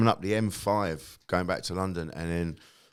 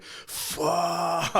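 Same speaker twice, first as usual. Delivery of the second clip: relieved swear; 8 semitones higher than usual. Retold or imitated. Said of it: "we just went".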